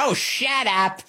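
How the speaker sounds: high, slow scornful shut up; high scornful sneer; mock dismissive. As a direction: brush them off in a high voice, laughing. Host mocks, then laughs.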